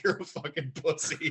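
words half lost in laughter; laughing. Real, not acted. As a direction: laugh while telling the punchline. Quoting dad, laughing.

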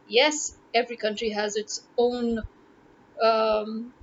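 ordinary delivery

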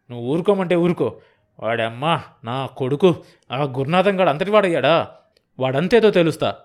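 loud, rising angry threats; furious husband. Acted. Enraged husband threatens Gurunatham.

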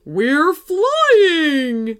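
high, loud, gleeful put-on voice; excited teddy. A narrator's reading. Teddy Marshall tells Jacob they are airborne, excited. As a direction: announce it with a swooping thrill.

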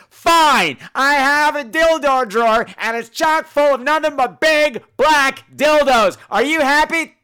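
loud, high, fed-up yelling; host shouting a sarcastic confession; exasperated shout. Real, not acted.

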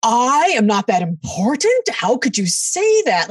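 mock-outraged gasp of a question; indignant shock. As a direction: replay being wounded and aghast, drawing it out. Acts out shock at insult.

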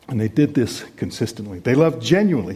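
ordinary delivery

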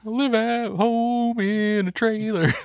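voz alta e lenta, arremedando com deboche; mocking echo. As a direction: drag it out high and loud, teasing your friend. Mocking S0's trailer line.

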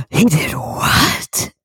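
slow breathless whisper of shock; whispered disbelief. Retold or imitated. Friend retelling watching the play, whispering in disbelief. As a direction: hush it like a stunned audience member. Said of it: "I was like".

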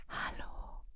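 a breathy whispered hello at a talk; unvoiced mock whispered greeting. Shown as a demonstration. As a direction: whisper it like the start of a presentation. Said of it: "Flüstern".

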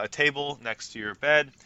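ordinary delivery

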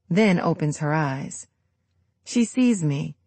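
plain reading voice

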